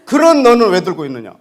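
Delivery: loud, high, furious demand; angry shout. Retold or imitated. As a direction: shout it as an accusation. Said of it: "막 이 사람이 막 화가 나는 거야". (화난 고함)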